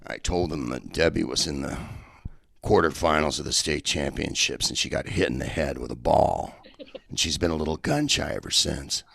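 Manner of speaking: Will's deadpan. Retold or imitated. Will reporting his lie to Debbie, low deadpan. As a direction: keep it low and dry as Will. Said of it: "And he goes".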